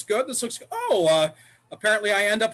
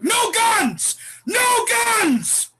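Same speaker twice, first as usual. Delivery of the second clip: shouting; 14 semitones higher, 4 dB louder, much slower than usual. Acted. Santa panics at a kid.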